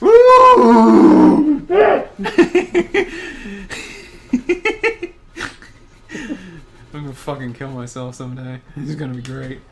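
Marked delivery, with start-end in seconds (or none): 0.0-5.1 squealing laugh with a whoop; high shrieking laughter. Real, not acted. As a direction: cackle and whoop, losing it. Laughing fit after outfit.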